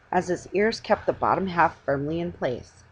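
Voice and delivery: ordinary delivery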